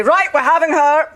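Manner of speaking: a light imitation of a decisive Scottish editor; imitating the boss. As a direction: announce it like a boss closing the matter. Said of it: "and he'd say".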